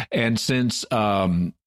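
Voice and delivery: usual speaking voice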